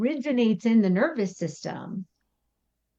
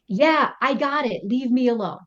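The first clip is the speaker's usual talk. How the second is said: a touch of annoyed brush-off, slightly higher; mild irritated snap; 4 semitones higher than usual. Retold or imitated. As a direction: brush him off, a little irritated. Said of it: "your response ends up being something like".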